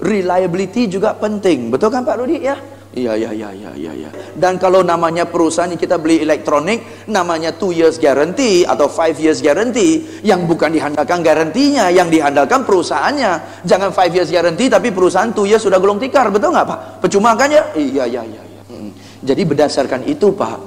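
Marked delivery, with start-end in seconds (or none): 2.9-4.1 low, soft, mumbled yes-yes of a customer; passive customer agreeing. Acted. Customer Pak Rudi nodding along. 17.7-18.9 low, soft, mumbled yes-yes of a customer; passive customer agreeing. Acted. Customer nodding along.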